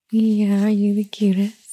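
usual speaking voice

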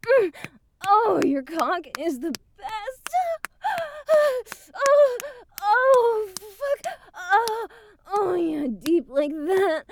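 high, breathy cries of pleasure, rising; pleasured moaning. Acted. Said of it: "Oh, that's so good".

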